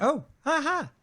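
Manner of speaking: high, loud burst of realisation; delighted discovery. Real, not acted. Spotting the wrong view mode at last, real excited realisation. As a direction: cry out as it suddenly clicks.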